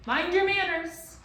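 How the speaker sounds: high, loud scolding screech; angry scream. A narrator's reading. Bird scolding the rude mob, angry scream. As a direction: scold them sharply. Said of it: "in an angry voice".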